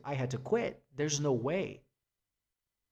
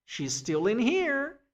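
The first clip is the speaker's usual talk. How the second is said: insistent worried friends; 8 semitones higher than usual. Retold or imitated. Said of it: "everybody was like".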